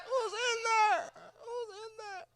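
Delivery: high, slow, very faint repeats; fading echo. Acted. Comic's question bounces back, fading echo. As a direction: let it die away high and tiny. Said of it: "First pussy echo".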